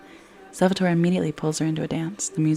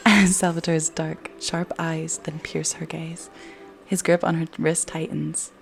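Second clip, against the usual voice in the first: ordinary talk, nothing marked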